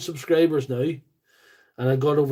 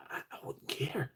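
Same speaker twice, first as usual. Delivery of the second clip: whispering; 16 dB quieter than usual. Real, not acted. Shrugging off a TikTok ban.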